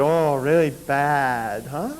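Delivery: lightly imitated Korean accent, slow and drawn out; a hint of the Korean master's accent, slowing each word. Master to his injured student, in a light Korean accent. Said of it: "still struggling with broken English".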